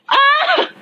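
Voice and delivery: sudden shrill shriek; comic high-pitched yelp. Acted.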